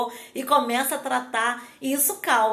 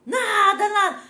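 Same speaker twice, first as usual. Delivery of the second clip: put-on high, louder, tearful child whine; boy keeps insisting he did nothing, teary; whiny crying child; 8 semitones higher, 6 dB louder than usual. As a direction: whimper the denial like a sulking kid. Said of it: "E começou a chorar de novo".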